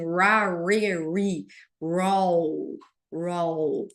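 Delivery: "ra, re, ri, roll" with a trilled R, low; rolled R drill. Shown as a demonstration. Teacher drilling the R sound for "mineral": rolled, low. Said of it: "a gente vai falar igual assim".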